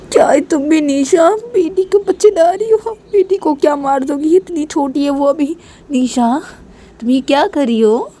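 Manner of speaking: remonstrate in high-pitched dismay; acted shocked scolding; horrified reproach. Horrified reproach over threatened baby.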